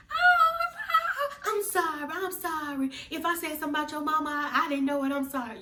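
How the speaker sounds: very high, squeaky fake apology; hurried fake-innocent cartoon squeak; Mickey Mouse cat voice. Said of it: "Mickey Mouse cat voice".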